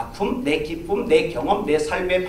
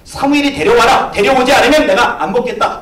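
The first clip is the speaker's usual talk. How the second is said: loud, high commanding shout; forceful prophet's demand; commanding Samuel; 6 semitones higher, 9 dB louder than usual. Acted.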